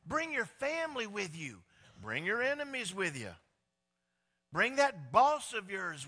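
a lightly voiced God urging everyone along; God speaking. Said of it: "And then he says".

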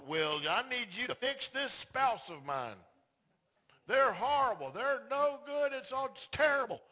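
loud, high complaint about the spouse; whining client. Acted. Husband whining to the counselor about his wife.

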